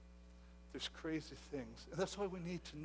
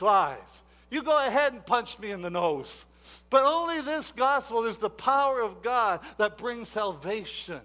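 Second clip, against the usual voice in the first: raised, loud pulpit voice; full-force sermon delivery; fiery preaching; 6 semitones higher, 11 dB louder than usual. Acted. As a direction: preach it to the back row.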